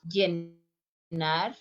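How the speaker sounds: LLENAR with a soft y, as "yenar"; soft y pronunciation. Shown as a demonstration. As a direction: say Llenar starting on a gliding y sound. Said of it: "Listen the pronunciation".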